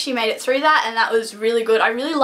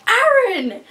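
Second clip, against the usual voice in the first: high, drawn-out cry of his name; imitated exclamation, pitched way up; acted-out protest; 12 semitones higher, 4 dB louder than usual. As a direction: call the name out in mock scandal. Voices Juliet protesting to him.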